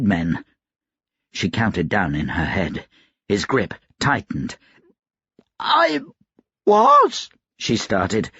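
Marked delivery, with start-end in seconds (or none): 5.6-7.3 very high, strangled squeak; choked, constricted throat. A narrator's reading. Laundress being strangled tries to answer, choked and high. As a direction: force the words through a tightening throat. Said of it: "feeling her throat constrict".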